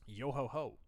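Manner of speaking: a quick hint of a pirate's yo ho ho; lightly voiced pirate. Streamer jokes about needing rum, light mock pirate voice. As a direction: toss off a mock pirate chant.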